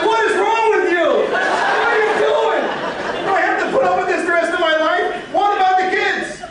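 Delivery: high, laughing wife voice in disbelief; mock exasperated wife. Husband imitating his wife scolding him, high pitched. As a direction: lay on the wife's exasperation, high. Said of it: "She said".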